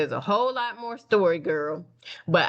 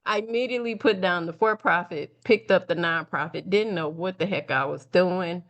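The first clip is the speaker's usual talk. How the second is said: usual speaking voice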